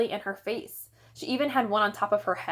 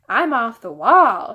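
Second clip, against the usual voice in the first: louder, slow gleeful repeat; proud repeat; 2 semitones higher, 10 dB louder, slower than usual. A narrator's reading. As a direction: relish saying it again. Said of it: "Fudge repeated".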